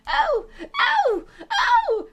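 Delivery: very high, loud shrieked "oh"s; screaming. A narrator's reading. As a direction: shriek like a steam-engine whistle. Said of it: "the Queen began screaming".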